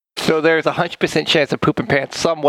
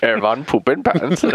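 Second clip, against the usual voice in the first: fake German accent; 4 semitones lower than usual. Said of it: "mixed my Russian and German there".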